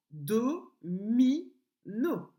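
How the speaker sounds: "do, mi, no" split into three separate syllables; sílaba por sílaba. Shown as a demonstration. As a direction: say "do, mi, no" with a pause after each syllable. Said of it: "sur la deuxième syllabe".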